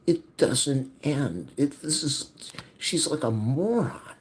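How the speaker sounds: loud, high and slow, at the end of his rope; exasperated outburst. Real, not acted. Son ranting about his mother, exasperated shouting. Venting, voice rising in frustration.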